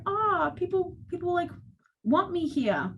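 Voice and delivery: a light retold moment of wonder; happy realisation. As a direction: let it dawn on you gently. Inner realisation quoted.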